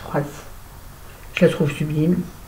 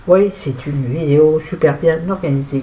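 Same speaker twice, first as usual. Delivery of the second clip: ordinary talk, nothing marked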